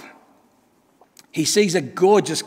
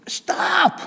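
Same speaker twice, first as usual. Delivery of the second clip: cry of stop. Acted. Cup cries out again.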